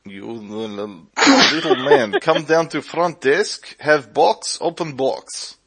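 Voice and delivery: put-on broken-English Middle Eastern accent; slow, halting Middle Eastern accent. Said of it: "I'm not gonna do a Middle Eastern accent".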